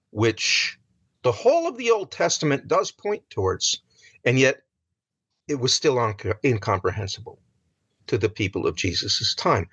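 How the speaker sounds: usual speaking voice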